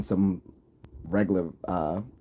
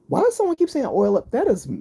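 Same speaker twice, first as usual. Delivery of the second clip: high-pitched, louder disbelief; incredulous outburst; 6 dB louder than usual. Real, not acted. Streamer reacting to a chat joke, incredulous. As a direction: blurt it out in a high, baffled voice.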